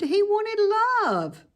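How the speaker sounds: usual speaking voice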